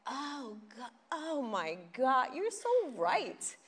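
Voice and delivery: high, loud, slow gushing "you're so right"; a loud, high, slow rush of realization. Retold or imitated. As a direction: gush like it just clicked. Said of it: "And I was like".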